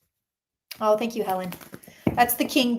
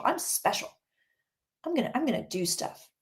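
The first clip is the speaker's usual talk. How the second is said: mock smug murmur; 2 semitones higher, 6 dB quieter than usual. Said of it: "He thinks he's special".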